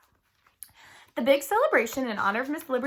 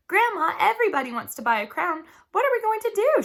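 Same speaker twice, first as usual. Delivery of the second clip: high, excited rush; excited girl; 9 semitones higher, 5 dB louder than usual. Acted. A girl runs to her grandmother, excited and high. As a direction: burst out the news excitedly.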